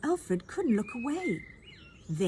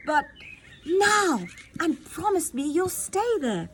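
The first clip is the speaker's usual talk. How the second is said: high, loud pleading command; urgent shout; 8 semitones higher, 5 dB louder than usual. Acted. Queen making her son promise to stay, urgent shout. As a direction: press him hard to promise. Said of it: "she shouted back".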